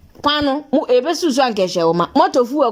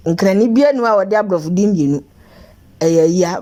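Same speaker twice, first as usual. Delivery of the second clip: usual speaking voice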